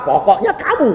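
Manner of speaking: high, insistent accusation; stubborn blame. Retold or imitated. Notary blaming the old clerk, high and stubborn. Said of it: "notarisnya ngotot".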